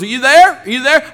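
high, loud calling into a bad line; shouting down phone. Caller checking the bad connection, shouting. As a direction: holler to check the caller is there.

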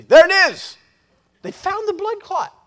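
loud, high-pitched shout of discovery; surgeon's shout. Retold or imitated. Surgeon calling to the team on finding the clot, shouted. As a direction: call it out loud and high across the room. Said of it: "I heard one of the surgeons say".

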